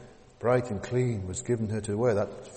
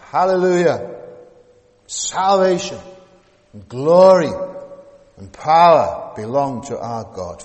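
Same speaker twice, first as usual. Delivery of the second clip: loud, higher exultant cry; shouting hallelujah; 4 semitones higher, 14 dB louder than usual. Acted. Preacher reading the heavenly multitude's cry, loud and exultant.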